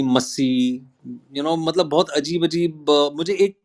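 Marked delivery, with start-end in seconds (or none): 0.0-0.8 "Masi" as MUSS-ee; another mispronunciation. Retold or imitated. Actor imitating another wrong surname, short MUSS-ee. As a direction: say "Masi" with a short uh and held final ee. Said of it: "they call me Masi".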